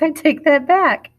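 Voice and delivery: laughing at herself. Real, not acted. Teacher laughs at her premature sign-off, laughing. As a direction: giggle as you retract the goodbye. Catches her own early goodbye.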